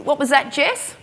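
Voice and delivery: high-pitched fake casual question; feigned cool. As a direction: act casual while secretly alarmed. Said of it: "Trying to play all real cool".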